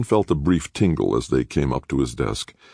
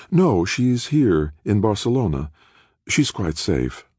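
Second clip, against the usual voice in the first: lightly voiced chairwoman; 8 semitones higher than usual. A narrator's reading. Halleck voiced by narrator.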